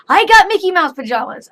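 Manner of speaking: put-on high, loud child boasting; excited kid announcing pajamas; proud little girl. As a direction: show off like a toddler. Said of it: "she's like".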